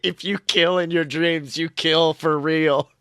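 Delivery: ordinary talk, nothing marked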